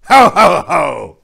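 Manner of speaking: loud, high jolly ho ho ho. Acted. Santa voiced.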